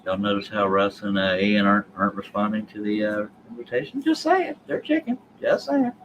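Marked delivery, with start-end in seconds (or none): 4.0-5.9 tiny high-pitched mock voice; playful high taunt; teasing falsetto. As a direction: needle them in a squeaky falsetto. Teasing guests who stay silent.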